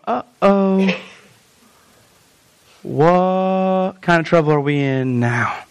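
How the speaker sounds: low, slow, worried mutter; nervous disciples. Retold or imitated. Disciples fearing trouble, low and slow. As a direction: sound like frightened men at a table. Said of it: "They're sitting at the table going".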